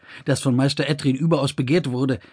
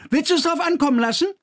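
high, fast, daring taunt; daring challenge; 14 semitones higher, faster than usual. A narrator's reading. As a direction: Kors dares Emde to stop him, fast and cocky. Kors daring Emde.

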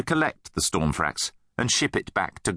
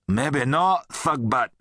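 coolly defiant friend, pitched up; cool retort; 5 semitones higher, slower than usual. A narrator's reading. Rudd steps in to defend Nate, cool. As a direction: stand up to a bully coolly. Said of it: "said Rudd coolly".

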